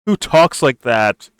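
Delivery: drawn-out, disbelieving who talks like that; slow exasperated disbelief; incredulous. Real, not acted. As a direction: stretch it out in disbelief. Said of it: "incredulous mocking repeat".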